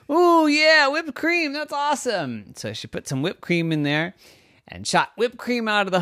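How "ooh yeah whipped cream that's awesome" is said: high squeal of delight; light up with a sudden idea; excited. Acted. Girl gets an idea.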